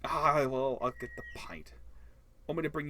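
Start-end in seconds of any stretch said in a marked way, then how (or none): 0.8-1.7 soft unvoiced whisper aside.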